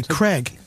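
"Craig" with a short e, like "Kreg"; American Craig. Retold or imitated. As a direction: say "Craig" as Americans do, rhyming with leg. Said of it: "can't say the word, the name Craig".

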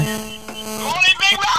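excited Vernon. Retold or imitated. Host imitating Vernon answering a call live. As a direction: greet gleefully. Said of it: "Vernon's ringing now".

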